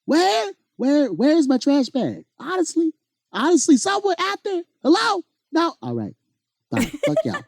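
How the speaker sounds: very high, fast hollering voice; a high, fast comic holler; Eddie Murphy. Pretend. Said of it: "You really do sound like Eddie Murphy".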